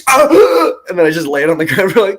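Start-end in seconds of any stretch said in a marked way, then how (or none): 0.1-0.7 hammed-up gasp and cry of a slain fighter; mock death cry. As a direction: gasp then high, loud dying shout. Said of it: "then I was just like".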